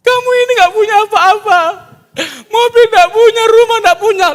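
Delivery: high, loud scolding shriek; angry wife. Acted. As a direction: nag him shrilly about the car and house. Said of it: "Pas sudah nikah marah-marah". (istri marah-marah dengan suara tinggi dan keras)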